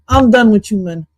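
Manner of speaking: ordinary talk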